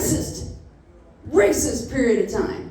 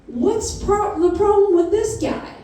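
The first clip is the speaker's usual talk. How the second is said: higher, innocent asking voice; curious question; 9 semitones higher than usual. Retold or imitated. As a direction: ask Grandpa innocently, pitched up. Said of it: "And I was like".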